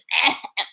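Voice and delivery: short loud choking hack; mock choking. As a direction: imitate a startled choke. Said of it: "She gonna be".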